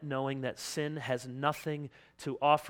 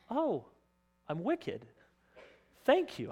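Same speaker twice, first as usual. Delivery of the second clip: high, jumpy faux-delighted reaction; mock gratitude; 7 semitones higher than usual. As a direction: act pleasantly surprised, tongue in cheek. Sarcastic voice of grateful hearer.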